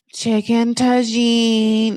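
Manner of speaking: slow, stretched-out mock girlfriend; drawn-out girlfriend reaction. Girlfriend hearing the dish named, drawn-out mock reaction. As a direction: draw each word right out as the girlfriend.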